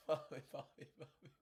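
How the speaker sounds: whispered through spent laughter; out of air from laughing; whispered wheeze; breathless laughing whisper. Real, not acted.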